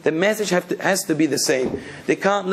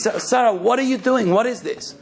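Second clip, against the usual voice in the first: higher-pitched, mild mock yell; retold scream; 6 semitones higher than usual. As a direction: retell an alarmed father's scream without truly shouting. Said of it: "So the father screams".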